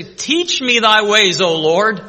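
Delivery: loud, high, drawn-out appeal; fervent plea. Acted. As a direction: call out slowly to the Lord. Acts people crying out to God.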